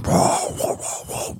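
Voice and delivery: rough, voiceless mock barks; imitated dog barking. Storyteller imitating the Rottweiler at the fence, gruff barking. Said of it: "this dog was going".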